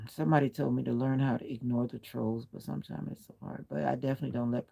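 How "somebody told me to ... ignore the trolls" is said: normal talking voice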